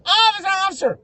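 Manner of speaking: very high, loud retold cry; excited. Caller spotting a cop coming straight, mock-excited shout. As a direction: call out in hammed-up excitement. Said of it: "I got excited".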